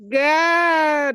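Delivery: loud, high, playful cry of protest; mock outrage. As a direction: call out in comic disbelief.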